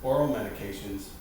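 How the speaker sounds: usual speaking voice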